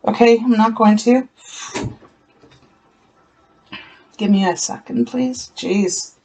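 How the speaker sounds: real choked-up voice, fading on please; holding back tears. Real, not acted. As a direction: fight off tears, breath catching. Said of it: "oh, I almost feel like crying right now".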